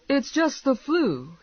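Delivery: high, slow wifely correction; exasperated wife. Acted. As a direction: set him straight in a high voice. Wife dismisses husband's dying.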